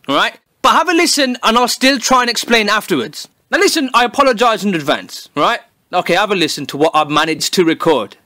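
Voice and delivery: normal talking voice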